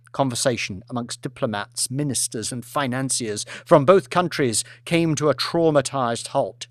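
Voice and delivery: ordinary delivery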